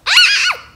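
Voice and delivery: high loud shriek of fright; sudden high scream; startled scream. Acted. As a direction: shriek as something flies at you. Something nearly hits her.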